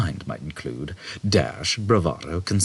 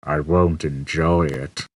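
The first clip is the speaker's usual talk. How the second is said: gloomy robot; 3 semitones lower, slower than usual. A narrator's reading. Marvin's voice.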